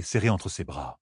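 plain reading voice

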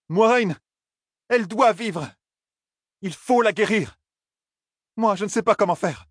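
a very high, frantic plea; begging for help he cannot give; desperate plea. A narrator's reading. Rand begs Moiraine to heal the girl, very high and frantic.

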